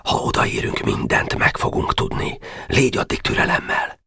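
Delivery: whispered command; hushing whisper. Acted. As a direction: silence him and calm him. Boka hushing the boys.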